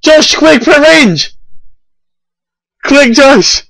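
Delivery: high, frantic yelling; panicked shouting. Real, not acted. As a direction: yell at him in panic. Panicked urging teammate.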